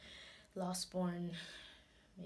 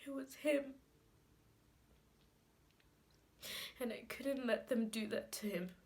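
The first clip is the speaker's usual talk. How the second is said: halting, higher voice with sobbing breaths; tearful grief; 4 semitones higher than usual. Acted. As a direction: let it out in broken pieces, long tearful pauses. Grieving monologue, crying subsides.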